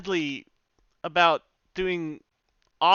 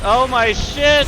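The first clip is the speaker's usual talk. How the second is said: excited; 8 semitones higher, 9 dB louder than usual. Real, not acted. Stunned by game attack.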